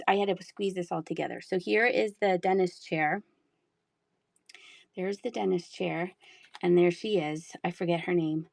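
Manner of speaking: ordinary talk, nothing marked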